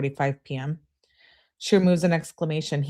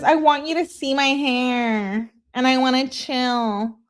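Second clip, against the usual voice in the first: put-on whiny girlfriend voice; 8 semitones higher than usual. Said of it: "that's how I envision her saying it".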